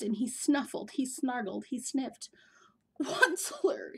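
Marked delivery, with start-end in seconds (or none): 3.0-3.9 a hoarse, higher cry of the name; croaky cry. A narrator's reading. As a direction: croak the name out like a sick old creature. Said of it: "cried with the cruffliest croak".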